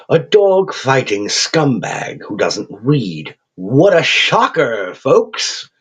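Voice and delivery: put-on slow, crooning English accent, gleefully cheerful; a cheerful English creature savouring every word. Raven creature chiding the thug in a cheerful English accent. Quoth's held voice.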